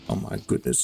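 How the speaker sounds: usual speaking voice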